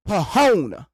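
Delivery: Pahona with a puffed P and a clear H; sounding out PH. Shown as a demonstration. Mocking silent letters, pronounces phone as Pahona. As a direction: say Pahona, voicing P and H apart. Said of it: "If you wanna put that P H on there".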